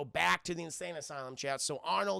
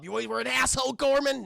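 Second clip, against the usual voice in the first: higher, loud film-quote bark; quoted movie defiance; 7 semitones higher, 6 dB louder than usual. Retold or imitated. Vasquez to Gorman before the blast, loud acted defiance. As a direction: throw out a defiant last line, raised voice.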